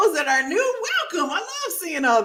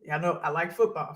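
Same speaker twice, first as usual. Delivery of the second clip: ordinary talk, nothing marked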